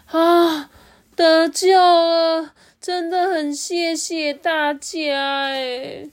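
high, slow, loud sigh of relief; relieved worm. Acted. Ajun thanking the rescuers, slow and relieved.

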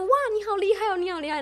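hammed-up admiring schoolkid; high mock praise. Classmates praising in retold story. (高声夸张的赞叹)

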